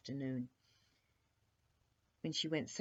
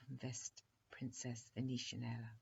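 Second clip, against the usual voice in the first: ordinary delivery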